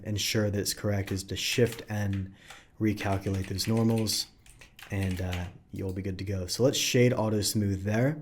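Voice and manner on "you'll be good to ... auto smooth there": normal talking voice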